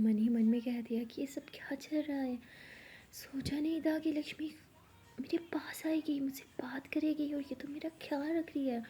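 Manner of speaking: softly whispered storytelling; whispered narration. Acted. Narrator hushes while telling the girl's inner thoughts, whispered. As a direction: draw listeners in with a hush.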